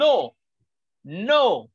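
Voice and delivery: ordinary talk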